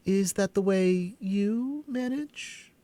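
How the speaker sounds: put-on high, slow girlish question; young Alice asking slowly; curious girl. A narrator's reading. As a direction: ask it wide-eyed as a little girl. Alice's voice in story.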